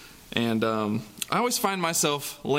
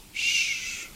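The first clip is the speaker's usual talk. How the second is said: hush. A narrator's reading. Hushing near sleeping lion.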